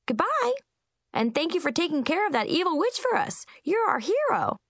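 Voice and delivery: high, bright Munchkin cheer. Acted. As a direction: cheer him on like a grateful kid. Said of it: "Chip cheered".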